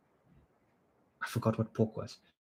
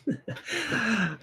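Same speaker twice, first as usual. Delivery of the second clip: a sudden high burst of laughter; 13 semitones higher, 5 dB quieter than usual. Real, not acted. Streamer reading a silly username aloud, bursting into high laughter.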